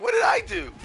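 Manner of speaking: high squeaky wail; comic protest. Acted. Stunned player asks why he was targeted, high mock protest. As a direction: cry out in high, mock-innocent disbelief. Voicing the player hit by rock.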